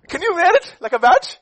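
high, loud challenging question; rousing challenge. Acted. Preacher challenging the congregation, high and loud. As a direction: throw the question out to the crowd.

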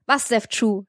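ordinary delivery

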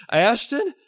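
high, loud questioning; Billy finding his wife's hand; startled question. Acted. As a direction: call her name in disbelief.

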